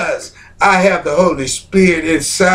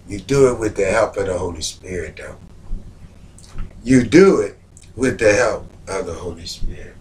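low, soft preaching voice; hushed preaching; 10 semitones lower, 8 dB quieter than usual. Real, not acted. Preacher quietly telling the congregation about forgiveness, low and soft. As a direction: pull them in close, lower and softer.

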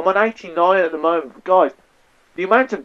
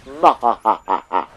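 low, gloating mock-evil laugh; hammed-up victory cackle; villain laugh; 6 semitones lower than usual. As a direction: laugh in slow separate ha-ha beats. Gloats over a kill.

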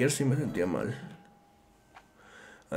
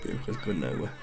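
much lower, quieter repeat; low deep voice; 8 semitones lower, 6 dB quieter than usual. Acted. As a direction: drop the voice way down.

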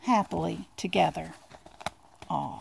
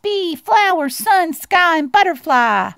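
high, marvelling mouse voice; high-pitched, word-by-word wonder; murmuring Little Mouse; 8 semitones higher, 5 dB louder, slower than usual. Acted. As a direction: list each wonder as an amazed little mouse. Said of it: "murmured Little Mouse".

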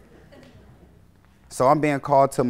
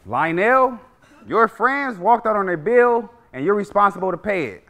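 higher, buttoned-up boss voice; uptight manager; 5 semitones higher than usual. Acted. By-the-book manager telling employee about the bill, uptight higher voice. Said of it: "Very uptight brother".

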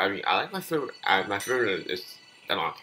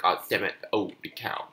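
ordinary delivery

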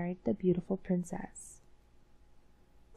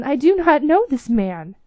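higher and louder protest; a touch of angry denial; 6 semitones higher, 10 dB louder than usual. Acted. Minister's son disowns the captain, mildly heated. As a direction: keep denying, heated. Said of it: "cried the minister's son".

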